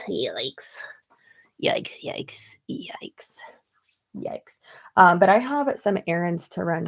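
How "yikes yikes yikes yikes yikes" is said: under-breath whispers of dismay, soft and airy; whispered yikes. Real, not acted. Vlogger spotting tan stain in her hair, whispering yikes. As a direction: mutter it to yourself, quietly embarrassed.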